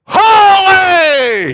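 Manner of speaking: full-force high, drawn-out shout; crying out. Acted. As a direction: bellow it to shake the pillars. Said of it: "cried out ... So loud that it shook".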